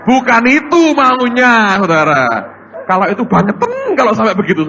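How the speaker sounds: a forceful preacher's shout; loud rebuke. Real, not acted. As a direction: thunder the correction at the church. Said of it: "preacher rebuking loudly". (teguran keras berteriak)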